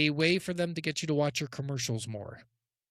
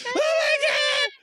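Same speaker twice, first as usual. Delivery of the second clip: shrieking high and slow in mock panic; imitated screaming; 5 dB louder, much slower than usual. Passenger mimicking her screaming in the car. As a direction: scream it like you think you'll crash. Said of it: "she was screaming ninety-five percent".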